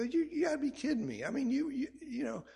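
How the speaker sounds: high, fast disbelief; incredulous. Retold or imitated. Pastor retelling his reply to Vivian, high, fast disbelief. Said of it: "I go".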